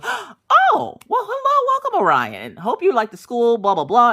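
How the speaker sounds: high, swooping mock-effusive greeting; gush, then rattle off the pleasantries; gushing welcome. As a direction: imitate an over-delighted greeter, high and loud. Lady gushes a welcome, retold.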